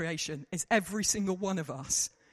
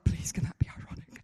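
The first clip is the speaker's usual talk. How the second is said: hushed, whispered begging; pleading under the breath; whispered plea. Retold or imitated. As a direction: whisper it like a desperate aside. Pleading reaction, said twice differently.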